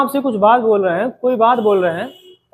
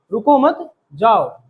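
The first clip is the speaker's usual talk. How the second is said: slow "रुको मत" as one unit, then "जाओ" apart; pause after मत; slower than usual. Shown as a demonstration. Teacher repeating the example that means go, pausing after "मत". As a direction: hold the break until after "मत", before "जाओ". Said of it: "और इसी को अगर ऐसे बोले".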